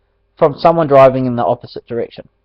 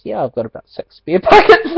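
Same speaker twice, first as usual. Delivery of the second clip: laughing quote; 6 dB louder than usual. Retold or imitated. Laughs while quoting dad.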